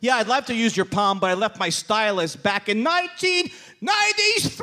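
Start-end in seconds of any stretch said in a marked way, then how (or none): 2.8-4.6 comic punchline shout. Acted. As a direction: stretch the year into a yell.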